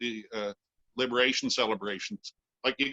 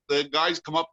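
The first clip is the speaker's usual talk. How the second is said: ordinary delivery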